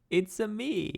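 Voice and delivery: high Italian plumber voice; high, cheery Mario catchphrase. Pretend. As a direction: bounce it out as Mario. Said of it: "Was that a good Mario impression?".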